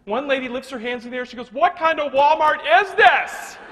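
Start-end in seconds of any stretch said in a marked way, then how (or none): 1.5-3.6 loud, slowed, pitched-up complaint with arms raised; mock-outraged shopper drawing the words out; exasperated outburst. Shopper fed up with the stuck express line, loud and drawn out.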